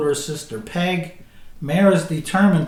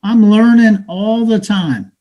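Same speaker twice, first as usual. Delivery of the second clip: street hustler taunting a newcomer; bitter, mocking street talk to a dreamer; cynical hustler; 5 semitones higher, 8 dB louder than usual. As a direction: talk down to him like a weary hustler.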